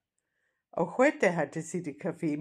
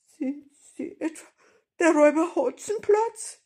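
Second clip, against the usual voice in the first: high, hesitant grandmother's voice; frightened; 11 semitones higher, 10 dB louder than usual. A narrator's reading. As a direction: ask it fearfully, pitched right up. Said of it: "mit ängstlicher Stimme fragte sie".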